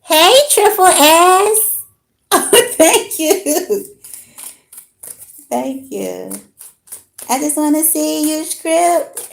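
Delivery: high, loud, slow hype welcome; light up and shout the name across the room; loud excited greeting. Real, not acted. Streamer hyping up the viewer Triple S with a loud high greeting.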